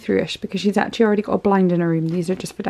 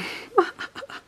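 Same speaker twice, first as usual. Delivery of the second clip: giggle; 14 semitones higher, 7 dB quieter than usual. Real, not acted. Laughing at a unicorn onesie.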